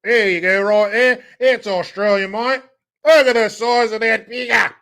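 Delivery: loud, high Aussie caricature; mock Australian accent. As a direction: shout like a hammed-up Australian. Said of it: "that might be Australian".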